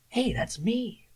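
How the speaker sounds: high-pitched mock surprise; delighted surprise. Voice actor hearing himself on car radio, mock surprise. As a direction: squeak it as a sudden recognition. Acted reaction to hearing own ad.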